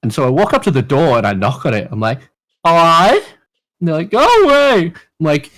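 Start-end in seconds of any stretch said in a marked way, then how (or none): 2.7-3.2 a loud, cheery hi through the door; loud call through the door. Retold or imitated. Knocking on bathroom door. 4.1-4.9 high, loud, upset go away. Retold or imitated. Crying cousin behind door.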